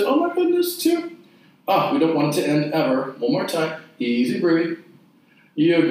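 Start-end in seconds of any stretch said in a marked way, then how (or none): 0.0-1.2 high mock gasp of amazement. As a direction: squeal it in playful disbelief. Mock exclaiming at hard reps.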